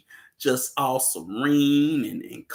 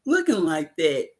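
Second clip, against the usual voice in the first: high, far-off disbelieving squeal; mock shocked high pitch; incredulous question. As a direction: ask it like you can't believe your eyes. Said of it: "I was like".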